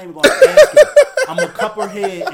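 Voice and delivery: loud words with laughter in them; laughing while talking. Real, not acted. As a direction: laugh it out as you say it.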